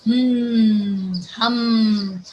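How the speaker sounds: loud, sustained "hmm"; imitated insect hum. Shown as a demonstration. Teacher imitates flies for children, humming. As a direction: drone like a buzzing mosquito. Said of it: "it start humming".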